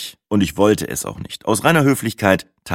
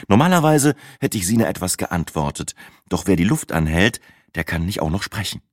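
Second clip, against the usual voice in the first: plain reading voice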